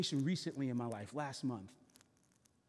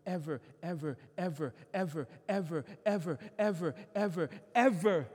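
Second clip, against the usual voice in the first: much higher and louder, then hammering ever, ever; full-force preaching; 5 semitones higher, 8 dB louder than usual. Acted. Pastor testifying to congregation, preaching at full force. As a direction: drive each ever home.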